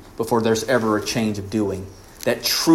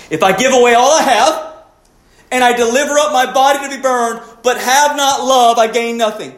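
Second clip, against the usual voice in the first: raised, ringing preacher's voice; preaching at full force; 9 semitones higher, 5 dB louder than usual. Acted. Preacher pressing the congregation, in full voice. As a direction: drive the charge home from the pulpit. Preaches Paul's verse and charge.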